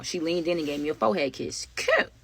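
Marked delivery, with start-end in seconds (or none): 1.8-2.1 squeaked high, cutesy cute; playful swoon. Real, not acted. Swooning after a forehead kiss.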